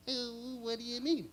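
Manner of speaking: puzzled little bear; Boo Boo voice. Retold or imitated. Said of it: "in cartoon voices".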